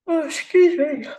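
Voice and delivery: words stretched through a yawn; high-pitched yawn as she speaks. Real, not acted. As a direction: let it come out mid-yawn. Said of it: "I apologize for my yawning".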